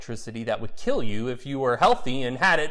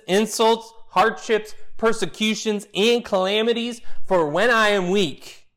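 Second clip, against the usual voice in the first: rising scripture climax; 8 semitones higher, 3 dB louder than usual. Acted.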